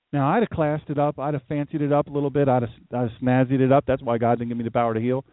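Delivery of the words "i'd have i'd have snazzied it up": ordinary talk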